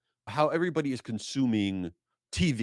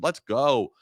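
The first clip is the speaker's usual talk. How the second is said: ordinary delivery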